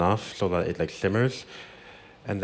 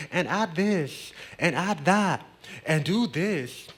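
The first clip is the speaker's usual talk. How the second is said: high, slow, sing-song kitchen guidance; 9 semitones higher, 4 dB louder, slower than usual. Retold or imitated. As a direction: coax each step out gently and high. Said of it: "she's there with you in the kitchen telling you".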